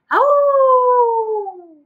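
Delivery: high wolf howl, long and loud. Shown as a demonstration. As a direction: howl like the winter wind. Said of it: "Can you make a howling sound".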